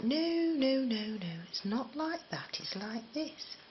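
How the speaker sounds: high-pitched imitation of mum's correction; correcting mum. Mum correcting her child's Brummy talk, imitated insistent mother. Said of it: "And she'd be like".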